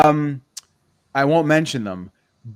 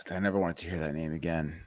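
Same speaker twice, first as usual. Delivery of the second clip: much softer and lower, a pained, dragging sigh of a line; weary groan; 8 semitones lower, 13 dB quieter than usual. Real, not acted. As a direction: groan it out as if the name hurts. Dreaded name of old coach.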